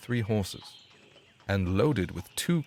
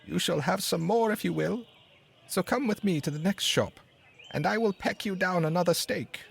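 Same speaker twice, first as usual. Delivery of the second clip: much higher little bird voice; sparrow; 10 semitones higher than usual. A narrator's reading. Sparrow offering the dog more steak, much higher bird voice. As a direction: keep the sparrow's voice up high. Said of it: "said the sparrow".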